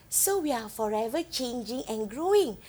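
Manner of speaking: ordinary delivery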